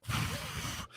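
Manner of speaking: imitated puff of breath to cool a drink; blowing on tea. Storyteller mimics an orangutan with hot tea, blowing. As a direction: blow out like an orangutan cooling tea. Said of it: "starts blowing on the tea".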